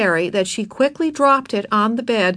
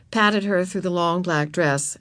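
plain reading voice

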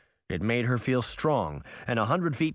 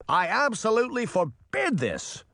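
higher, forceful father's voice; shouted; 9 semitones higher than usual. A narrator's reading. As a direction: forbid it outright. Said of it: "Henry shouted".

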